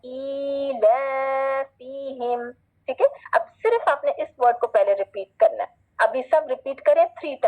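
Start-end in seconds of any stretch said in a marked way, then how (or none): 0.1-2.5 soft, very slow chant of ईलाफिहिम; teacher modelling for repetition; Arabic recitation. Shown as a demonstration. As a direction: recite ईलाफिहिम on one note, vowels stretched. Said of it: "अब जैसे हमने पहले पढ़ा ना".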